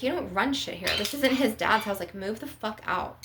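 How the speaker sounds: normal talking voice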